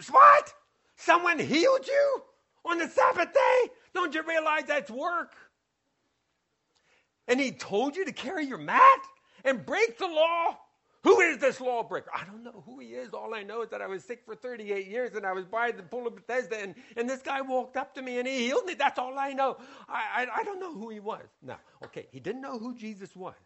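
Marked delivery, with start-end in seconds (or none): 0.1-12.1 high, loud indignant shout; outraged accuser. Acted. Accuser outraged at the Sabbath healing, shouting. 12.1-21.3 fast, soft nervous explaining; hurried defense. Acted. Healed man defending himself to the accuser, fast and soft.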